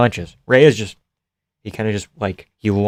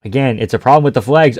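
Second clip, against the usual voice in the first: ordinary talk, nothing marked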